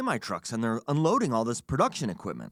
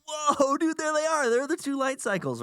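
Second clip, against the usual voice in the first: excited; 11 semitones higher than usual. Retold or imitated. Excited at seeing stunt bikes.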